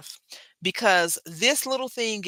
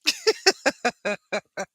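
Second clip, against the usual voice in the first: high laugh; 9 semitones higher than usual. Real, not acted. Laughing at empty shelves.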